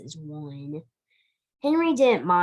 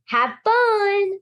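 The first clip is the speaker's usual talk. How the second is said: much higher, louder, beaming goodbye; with a big smile; 12 semitones higher, 5 dB louder than usual. Acted. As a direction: send the boys off cheerfully, smiling. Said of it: "with a big smile".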